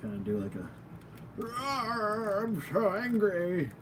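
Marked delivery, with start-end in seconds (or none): 1.4-3.7 put-on old man, pitched higher and softer; playful higher old man voice for the angry toy head. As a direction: whine it like a wounded old man. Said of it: "I'm doing old man voice right now".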